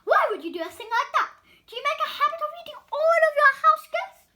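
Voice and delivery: high, loud, fast indignant rant; outraged. Acted. Donut Arnie rants at Mister Bing, loud and fast. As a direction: fire it off in disbelief.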